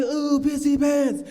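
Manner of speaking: high, slow teasing chant; sing-song taunt. Retold or imitated. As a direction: tease like a playground taunter. Mocking nickname in retold story.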